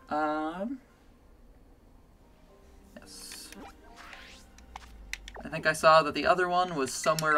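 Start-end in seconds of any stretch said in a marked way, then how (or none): none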